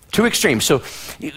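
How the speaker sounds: normal talking voice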